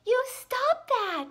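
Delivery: put-on high, loud, slow cross girl; high indignant girl voice; protesting girl. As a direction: tell them off like a prim little girl. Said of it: "said Susan".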